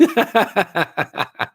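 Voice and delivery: high laugh in a quick descending run. Real, not acted. Host cracking up at his own clingy-shower joke, laughing. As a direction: burst into high-pitched giggling.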